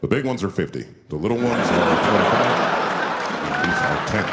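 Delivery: deadpan chief pricing weed bags; deep chief voice. Retold or imitated. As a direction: sell the weed like a grave elder.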